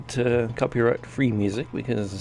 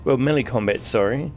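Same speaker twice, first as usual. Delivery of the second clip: ordinary talk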